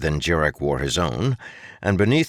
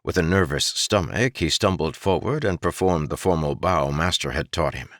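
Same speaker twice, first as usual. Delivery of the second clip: ordinary delivery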